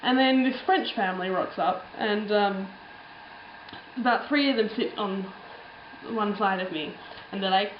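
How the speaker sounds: ordinary talk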